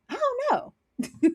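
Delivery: high, slow, drawn-out mock voice; mock clueless. Streamer playing dumb about the plot, in a high, slow voice. Mock helpless reply to question.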